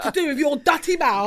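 loud, high, laughing imitation of a refusal; mock outraged John. Host imagines John refusing a guest, mock outraged shout. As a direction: shout it laughing, as if turning someone away. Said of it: "John's like".

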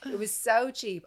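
usual speaking voice

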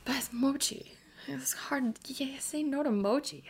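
soft unvoiced whisper. Real, not acted. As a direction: confide the excuse in a whisper. Whispered excuse about mochi.

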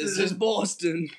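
put-on slow, loud, drawn-out Boston accent. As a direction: belt it out in a thick Boston accent.

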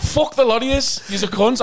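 high, slow laughing jeer; laughing mock insult. Real, not acted. Laughing at friends while pretending to curse them.

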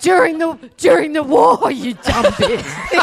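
loud, high comic shout; hammed-up outburst, slowing on the insult; mock furious retort. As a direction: yell it at someone slow to understand. Retold angry retort to nurse.

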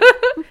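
loud high-pitched cackle; high laugh. Real, not acted. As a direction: burst out laughing. Laughing at masturbation joke.

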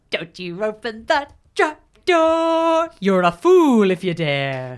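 slow, loud, dramatic warning; hammy loud warning. Pretend. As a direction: bellow it like a horror fan. Mock warning to story character.

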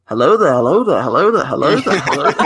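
loud echoing hello there, repeated. Retold or imitated. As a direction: call it out like a distant echo. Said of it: "a reverberating echo going".

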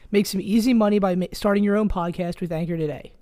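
upbeat, fast sponsor read. Acted. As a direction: sell it like a podcast ad.

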